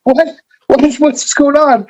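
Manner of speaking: very high, slow bewildered squeal; mock freaking out. Wrestler reacting to a phoned threat, hammed-up freak-out. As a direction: panic in an exaggerated high voice. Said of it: "he's, like, freaking out".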